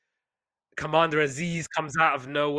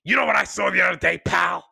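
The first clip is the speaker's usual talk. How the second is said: loud, quick boss pitching an idea; lightly voiced excited executive; Vince McMahon; 3 semitones higher, 4 dB louder than usual. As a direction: burst out with a bright idea, then announce it. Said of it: "I do think Vince McMahon, I'm sure he's like".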